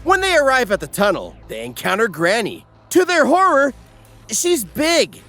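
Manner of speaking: normal talking voice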